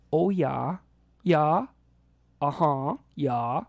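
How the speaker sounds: Scandinavian-tinged northern Midwest lilt; Norse Midwest accent. Shown as a demonstration. Showing how northern Midwesterners talk, Norse-tinged accent. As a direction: say it with Swedish-style Midwestern vowels. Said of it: "the Norse accent".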